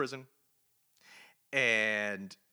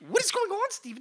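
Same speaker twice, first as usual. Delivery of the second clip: baffled; 6 dB louder than usual. Real, not acted. Confused review rant.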